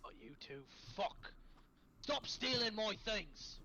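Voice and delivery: put-on irritated creature telling them off; pissed off. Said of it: "he's kind of pissed off".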